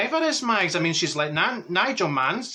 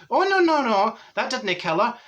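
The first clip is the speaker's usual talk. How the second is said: emphatic denial; 7 semitones higher than usual. Retold or imitated. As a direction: hurriedly put the friend right, pitched high. Retold denial to shocked friend.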